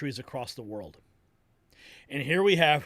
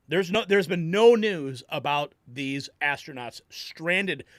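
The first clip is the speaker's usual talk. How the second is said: ordinary delivery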